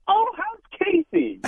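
slow, high, sugary imitation of his wife; sweet teasing wife. Wife teasing her fuming boyfriend about his rival, slow sweet high voice. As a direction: coo it innocently to needle him. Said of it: "as soft and sweet as she possibly can".

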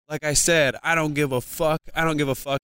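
mildly heated, repetitive defiance; retold anger. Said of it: "angrier and angrier".

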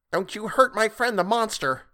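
put-on high-pitched little boy's voice; high, protective young voice; defiant child. A narrator's reading. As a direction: stand up for a friend as a small boy. Small boy defends the monster.

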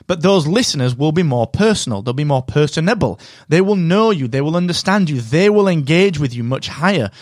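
ordinary talk, nothing marked

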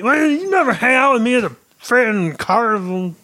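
high, strained put-on comic voice; Bobcat Goldthwait impression. Dwarf defending his whittling to Droth, high strained comic voice. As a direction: squeeze it up into a twitchy stand-up whine. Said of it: "This is Bobcat Goldthwait".

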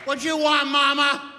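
high, loud worker voice with a laugh; drive-thru worker. Acted. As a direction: call out the order question. Drive-thru worker asking order.

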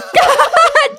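high burst of exasperated laughter; bitter laugh. Real, not acted. Hearing the train is only an hour late, an exasperated laugh. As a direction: laugh at the absurdity.

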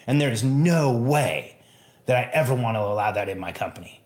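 ordinary delivery

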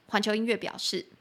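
usual speaking voice

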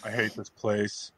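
low, slow, fed-up grumble; sulky voice muttering it; gloomy low mutter. Retold or imitated. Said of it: "Walks in".

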